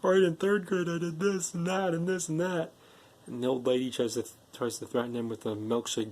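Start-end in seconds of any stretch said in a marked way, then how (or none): 0.0-2.7 high-pitched mock crying confession; imitating tearful freak-out. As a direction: babble it out, pretending to cry. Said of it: "like, crying and s-, freaking out".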